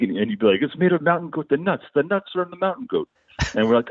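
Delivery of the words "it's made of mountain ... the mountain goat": high-pitched imitation of a thick accent; Borat-like heavy accent. Bandmate explaining his soup, in a heavy Borat-like accent. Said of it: "he spoke, uh, basically like Borat".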